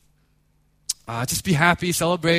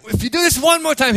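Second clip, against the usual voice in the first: high, raised parental warning; retold threat; 8 semitones higher, 4 dB louder, faster than usual. Father about to threaten his daughter, raised warning voice. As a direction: imitate a fed-up parent's threat. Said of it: "threaten her with this".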